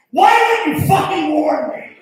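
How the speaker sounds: high, loud comic outrage; outraged shout. Acted. Boyfriend was not warned about the mother, outraged shouting. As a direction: shriek your complaint in disbelief.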